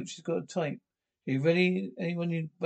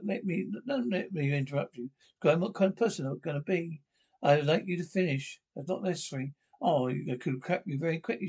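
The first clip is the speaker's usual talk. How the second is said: ordinary delivery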